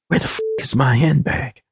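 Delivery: contained flash of alarm; cursing. A narrator's reading. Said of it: "Diamond cursed".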